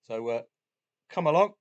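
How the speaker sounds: normal talking voice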